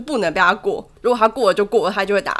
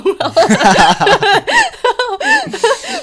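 long rising laugh, high and loud; 14 semitones higher, 7 dB louder than usual. Real, not acted. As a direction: crack up completely. Laughing at own story. (放声大笑)

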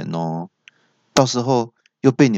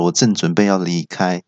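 ordinary talk, nothing marked

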